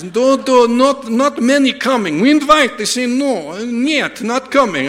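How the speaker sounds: put-on high, loud Russian-accented rant; hammed-up Russian naysayer; Russian driver. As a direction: pile on refusals in a thick Russian accent. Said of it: "And he goes".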